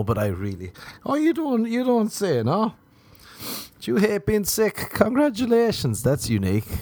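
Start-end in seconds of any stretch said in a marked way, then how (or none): 1.0-2.7 mock surprise. Mocking someone who hates sickness. 3.8-6.0 high, mock-impressed praise; sarcastic congratulations.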